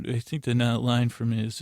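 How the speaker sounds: usual speaking voice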